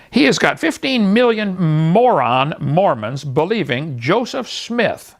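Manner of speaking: slow, weighty denunciation; slow emphatic. Real, not acted. Preacher slowly condemning believers. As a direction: drag out each word to condemn.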